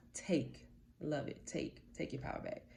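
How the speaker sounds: hushed, intimate whisper; whispered aside. Real, not acted. Soft emphatic aside.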